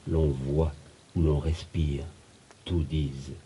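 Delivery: soft, low, fading close; hushed ending. Acted. Nature bearing witness to the lovers, hushed. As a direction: let the voice sink gently to rest. Poem's tender closing lines.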